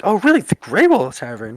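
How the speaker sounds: high, loud burst of surprise; shocked Alyssa. Acted. Alyssa turning to her friend about the tavern, shocked. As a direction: react in shock, pitch jumping up on "really". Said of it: "Alyssa turns to you in a shock".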